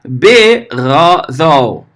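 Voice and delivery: Berodol said slowly, syllable by syllable; teacher sounding out Berodol; slow spelled-out word. Shown as a demonstration. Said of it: "vai formar essa palavra linda aqui".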